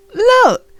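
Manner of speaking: high, loud excited cry; excited child. Acted. Franklin showing his parents, excited high cry. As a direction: burst out in discovery.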